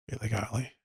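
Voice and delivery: soft hushed echo; quiet echo. Shown as a demonstration. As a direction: let it fall to a hush.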